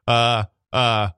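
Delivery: usual speaking voice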